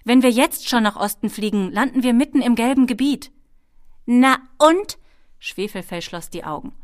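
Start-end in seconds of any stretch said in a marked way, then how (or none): 0.0-3.3 loud warning, climbing higher; calling out. A narrator's reading. Ben warns the kobold of the yellow zone, calling out. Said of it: "rief er". 4.0-5.0 loud, high kobold retort; defiant kobold. A narrator's reading. Kobold dismisses Ben's warning, loudly defiant.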